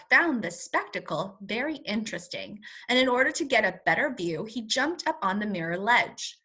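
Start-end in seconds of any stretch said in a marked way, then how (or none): none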